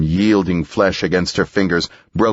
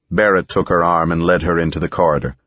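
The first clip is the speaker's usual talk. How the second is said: ordinary delivery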